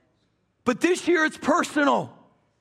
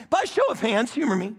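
ordinary talk

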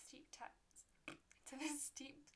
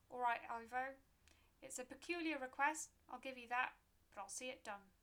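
put-on loud, hearty old gravedigger; hearty graveyard keeper; 3 semitones higher, 8 dB louder than usual. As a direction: boom out a happy agreement. Keeper accepts with gusto.

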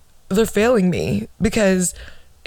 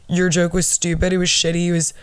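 usual speaking voice

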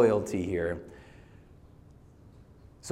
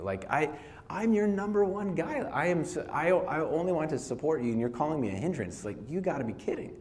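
lightly voiced, higher wounded protest; hurt Peter; 6 semitones higher than usual. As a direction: plead like a stung loyal friend. Peter's hurt reply to Jesus.

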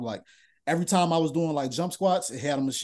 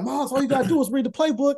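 nagging coaches; 10 semitones higher, 5 dB louder than usual. Retold or imitated. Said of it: "They'd be like".

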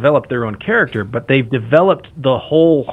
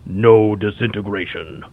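low, slow bounty hunter rasp; gravelly voice, a touch of menace; cool gravelly voice; 4 semitones lower, slower than usual. Retold or imitated. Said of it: "that cool gravelly voice".

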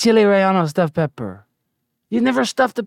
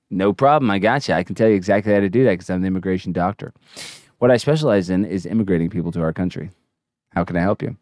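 low doctor voice; 12 semitones lower, much faster than usual. Acted. Answering as the immigration doctor.

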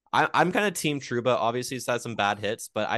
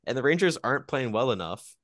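usual speaking voice

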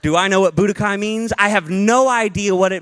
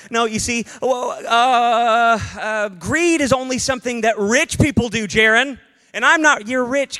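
mock defensive listener; 7 semitones higher than usual. Preacher mimics a defensive church member, whining. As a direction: play the congregant who insists he's not greedy. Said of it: "voicing a defensive listener".

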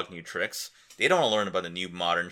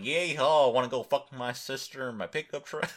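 mock American southern accent, a bit higher; 5 semitones higher than usual. Vlogger mocking a southern American accent, mock Southern accent. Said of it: "a southern dialect".

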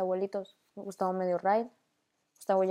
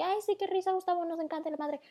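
mock fake gushing; 9 semitones higher, faster than usual. Mocking their own fake reply.

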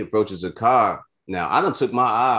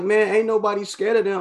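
much higher, cocky defiant retort; defiant scoff; 4 dB louder than usual. Retold or imitated. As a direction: brush off the warning with bravado.

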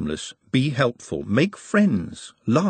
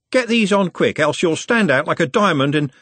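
higher, louder street-lad banter; cocky Trev, teasing; 7 semitones higher, 4 dB louder than usual. A narrator's reading. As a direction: rib your mate like a cheeky know-all.

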